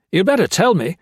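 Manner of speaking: threatening. Acted. Gray threatens Blakely.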